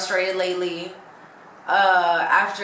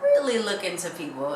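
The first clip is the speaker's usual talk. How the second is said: usual speaking voice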